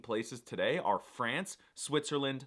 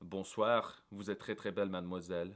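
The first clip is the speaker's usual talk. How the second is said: low, softer smooth French pickup line; seductive French line; 6 semitones lower, 3 dB quieter than usual. Acted. Pickup line to a cowgirl, low and smooth. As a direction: purr it like a lightly done charmer. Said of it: "look them in the eyes and say".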